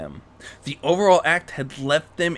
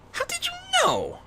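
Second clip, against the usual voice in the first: sudden high startled question; Rarity caught out by Bruce, very high; surprised gasp. Acted. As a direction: blurt it, caught out. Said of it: "Rarity gasped".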